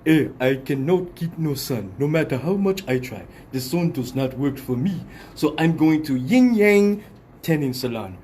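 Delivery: deliberate African accent, voicing an advert customer. Albino customer in a tanning salon commercial, African accent. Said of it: "gonna show an African albino".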